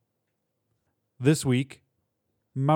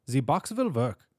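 put-on thick German-Polish accent, W said as V; swooping accented exterminator, German or Polish; 3 semitones higher than usual. A narrator's reading. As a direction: declare it in a Central European accent. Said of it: "the accent German or Polish".

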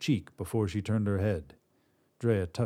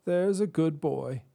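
put-on soothing old woman, pitched high; an old woman coaxing her dog; old Drea; 8 semitones higher than usual. Old woman praises her dog, pitched high.

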